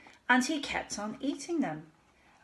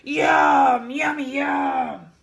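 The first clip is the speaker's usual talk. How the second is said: loud roaring monster, slow; beastly roar; 4 semitones higher, 9 dB louder, much slower than usual. Acted. Daddy tickling Charlie, roaring like a beast. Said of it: "roaring in a very beastly voice".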